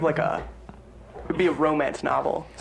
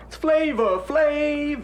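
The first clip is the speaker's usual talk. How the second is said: high-pitched catchphrase impression; 11 semitones higher, much slower than usual. Friend explains the rapper's catchphrase, high drawn-out imitation. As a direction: pitch it way up and hold the name. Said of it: "he says it so fast".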